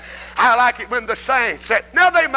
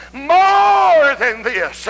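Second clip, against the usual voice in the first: MORE stretched long, loud and high; drawn-out MORE; 4 semitones higher, 5 dB louder, much slower than usual. Acted. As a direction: hold MORE in a slow, rapturous cry. Voices bride's emphatic declaration.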